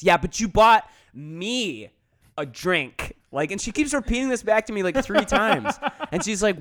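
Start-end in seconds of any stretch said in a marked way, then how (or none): 0.0-3.2 slow and louder, pausing after "me" before "a drink"; insistent repetition. Retold or imitated. Drunk woman repeating her point to him, slowly insistent. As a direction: stretch "you bought me", pause, then drop "a drink".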